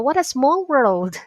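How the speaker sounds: surprised and laughing; surprised greeting. Acted. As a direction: act bumping into a friend by chance. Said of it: "Something like that".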